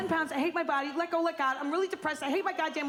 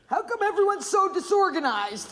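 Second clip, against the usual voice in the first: lightly voiced spacey mom, a touch higher; stoned mom; 4 semitones higher, 3 dB louder than usual. Stoned mother asking her daughter at the march. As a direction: play the dazed pothead mother. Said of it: "she says to me".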